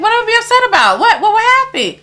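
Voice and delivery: high, anxious questioning; retold alarm. Narrator questions the crying girl about being upset, retold alarm.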